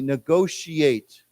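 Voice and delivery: negotiate said slowly, syllable by syllable; slow model word. Shown as a demonstration. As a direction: spell out negotiate slowly for the class. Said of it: "let's just say the, the root word here".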